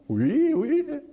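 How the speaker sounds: sudden high squeaky cry of glee; high giddy "ui, ui"; delighted squeal. Real, not acted. Eager delight at teacher's invitation.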